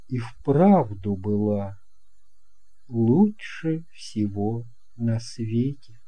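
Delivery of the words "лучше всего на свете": normal talking voice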